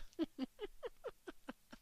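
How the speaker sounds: high amused laugh; high giggle. Acted. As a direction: burst out laughing at the secret. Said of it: "fragte Susanne lachend nach".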